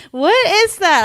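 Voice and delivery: mock surprise. Reacting to the shrunken sweater.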